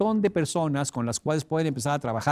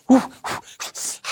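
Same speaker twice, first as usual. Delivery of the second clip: pained breathy puffs; 8 semitones higher than usual. Pretend. Pain from crushed finger.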